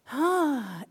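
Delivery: normal talking voice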